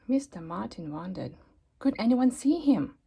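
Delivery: ordinary delivery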